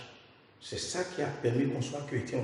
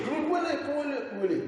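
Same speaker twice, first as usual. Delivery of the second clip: crowd shouting crucify him; 13 semitones higher, slower than usual. Retold or imitated. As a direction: cry it out like the mob calling for his death.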